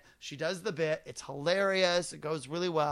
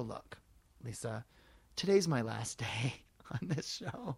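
much softer, lower, intimate pleading; soft plea; 5 semitones lower, 6 dB quieter than usual. Retold or imitated. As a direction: lean in and beg gently. Pleading with guest.

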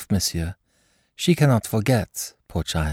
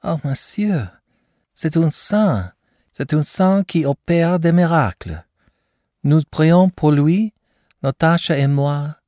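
gushing praise; 7 semitones higher than usual. Acted. Mother gushing about the doctor.

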